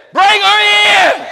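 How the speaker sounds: slow, huge, high bellow of an exasperated doctor; doctor losing patience, bellowing the order; booming command. Retold or imitated. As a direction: roar the order out, dragging each word. Doctor's final, exasperated insistence.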